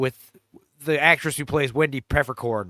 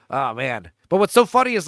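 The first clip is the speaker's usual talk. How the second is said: ordinary delivery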